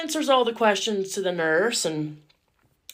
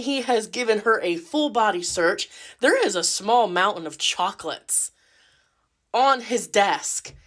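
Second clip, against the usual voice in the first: usual speaking voice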